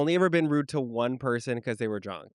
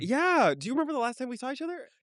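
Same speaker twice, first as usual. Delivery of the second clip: high-pitched fake friendliness; put-on chummy recognition, pitched up; pretend familiarity; 11 semitones higher than usual. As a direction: play along as an old acquaintance. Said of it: "let's pretend".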